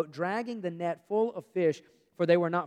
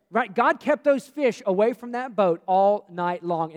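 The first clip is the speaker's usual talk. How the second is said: preaching; 4 semitones higher, 10 dB louder, slower than usual. Acted. Preaching at full force.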